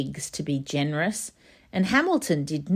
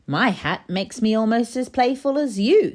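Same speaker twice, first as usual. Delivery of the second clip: higher, louder playful piglet; 7 semitones higher, 3 dB louder than usual. Acted. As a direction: play it like a cheeky piglet. Pig speaks to monkeys.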